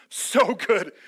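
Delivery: loud, upbeat "So good!" at a high five; excited "so good". Retold or imitated. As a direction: cheer it to a friend. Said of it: "high five".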